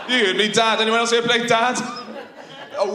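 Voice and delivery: ordinary talk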